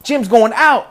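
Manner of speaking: loud high alarmed yell; tribe shouting. Retold or imitated. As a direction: holler it out, shocked. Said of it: "everyone around the tribe is like".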